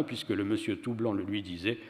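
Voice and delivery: ordinary delivery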